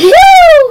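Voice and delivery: loud sudden sneeze. Real, not acted. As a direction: let out a big sneeze. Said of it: "That's what I did".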